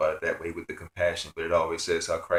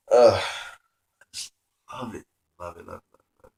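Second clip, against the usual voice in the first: choked with emotion, fading to a whisper; overcome, near tears; 4 dB louder than usual. Real, not acted. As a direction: voice breaking as it fades out. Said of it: "And I'm trying not to cry".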